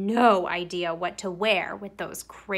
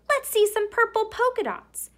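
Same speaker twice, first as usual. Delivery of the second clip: much higher, louder taunting shout; kid yelling; 13 semitones higher, 4 dB louder than usual. Acted. Classmate shouting at Camilla, high loud taunt. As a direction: yell it like a teasing kid. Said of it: "One yelled out".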